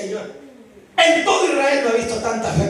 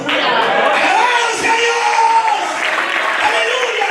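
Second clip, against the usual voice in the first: high, drawn-out shout of praise; jubilant shout; 9 semitones higher, much slower than usual. Real, not acted. Preacher shouting praise to the congregation, jubilant. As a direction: cry out praise to God, stretching it.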